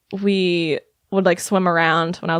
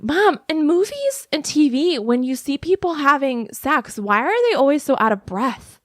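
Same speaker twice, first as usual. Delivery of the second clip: imitating curious child; 8 semitones higher than usual. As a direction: play your younger self putting a question to mom. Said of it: "the question she loves to remind me that I asked".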